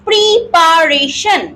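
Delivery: Preparation stretched slowly as pri-pa-re-shan; slow syllable by syllable. Shown as a demonstration. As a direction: draw out each syllable of preparation, joined up. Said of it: "এইভাবে যদি আমরা". (Preparation টেনে টেনে, ধীরে)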